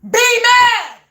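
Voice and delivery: loud, high be mad thrown at them; taunting shout. Real, not acted. Telling the neighbors to stay angry, loud taunt. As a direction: bark it back at them.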